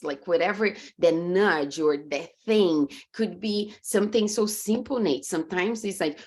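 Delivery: ordinary delivery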